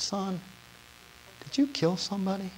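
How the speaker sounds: soft divine voice; gentle voice of God. Acted. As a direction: ask it quietly, like a patient father. God speaks to the preacher.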